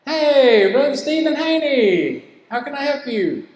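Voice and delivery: light up when you see the name; cheerful phone greeting. Shown as a demonstration. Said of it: "if there are names on caller I D, say".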